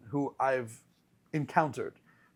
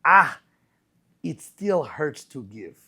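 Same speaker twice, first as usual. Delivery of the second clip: put-on light Russian accent, slow and under the breath; a mild hint of a Russian accent, drawn out; lightly imitated Russian accent, slow; 6 dB louder, slower than usual. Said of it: "uniquely Russian accent".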